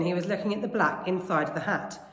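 usual speaking voice

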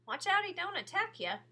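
a hint of worry in the warning; lightly voiced nervous boy; boy's warning. A narrator's reading. Rob warning Sistine.

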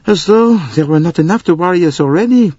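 a fretting high voice, worried and fussy; high fretful voice. Acted. Fretful character complaining about the snow to Poirot. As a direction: voice her rising fret.

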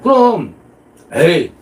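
usual speaking voice

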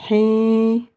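"Hi." with a long sustained i; long vowel. Shown as a demonstration. Teacher shows the same for i, "Hi." held long. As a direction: stretch "Hi." on one even note. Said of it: "avec la voyelle longue".

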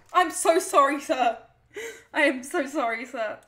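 loud, hammed-up grovelling apology to a customer; over-the-top apology. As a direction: beg forgiveness theatrically.

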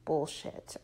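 hushed, very soft, teeth shut; whispered through clenched teeth. A narrator's reading. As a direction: spit it out under your breath. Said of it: "I whispered through clenched teeth".

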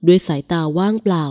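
ordinary delivery, nothing marked

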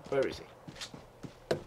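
slow hushed whisper. Real, not acted. Gamer hunting an enemy in infrared, slow whisper. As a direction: breathe it while stalking him.

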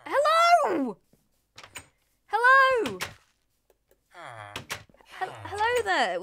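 high, loud friendly hail; calling out. Real, not acted. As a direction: shout hello to the new customers. Calling out to villagers.

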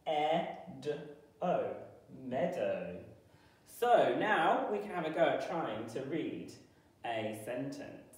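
ordinary talk, nothing marked

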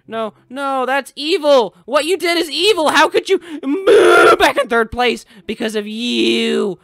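high, loud outraged yelling. Real, not acted. Gamer raging at a racer who knocked him back, loud yelling. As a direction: rage at the rival. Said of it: "I'm not actually that mad".

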